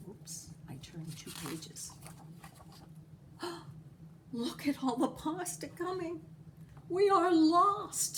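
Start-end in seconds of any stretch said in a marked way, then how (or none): 2.0-4.3 frightened gasp. A narrator's reading. People see the pasta. 4.3-8.2 panicked townspeople. A narrator's reading. People panic at the pasta.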